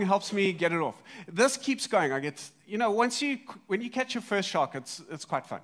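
ordinary delivery

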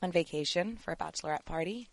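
playful put-on American Valley Girl voice; light mock-American accent. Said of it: "You're doing the accent".

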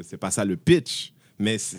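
ordinary talk, nothing marked

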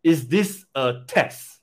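"Is this a test" slowly, word by word; wondering. Acted. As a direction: draw out IS THIS A TEST as a slow, pondering question. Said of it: "he's wondering".

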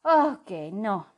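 slow, loud grudging admission. A narrator's reading. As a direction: admit it reluctantly. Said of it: "reconoció a regañadientes".

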